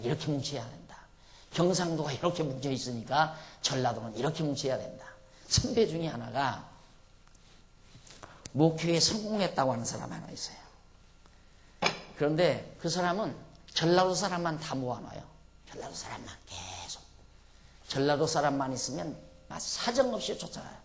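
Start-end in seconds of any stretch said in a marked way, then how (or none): none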